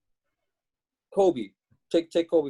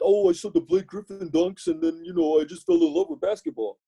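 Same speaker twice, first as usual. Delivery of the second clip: mocking fan voice; 10 semitones higher than usual. Mocking bandwagon Clippers fans.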